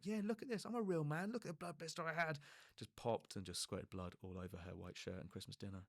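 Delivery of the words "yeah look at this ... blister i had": higher, cocky showing off; playing the tough man; hammed-up boast; mock macho bravado. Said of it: "my attempt to be like".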